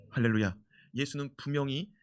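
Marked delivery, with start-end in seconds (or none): none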